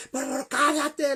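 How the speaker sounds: high, loud imitated begging; tearful plea. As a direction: act out a desperate wife begging for silence. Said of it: "comenzó a llorar y a llorar". (súplica llorosa, aguda y fuerte, imitada)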